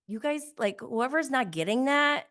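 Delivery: normal talking voice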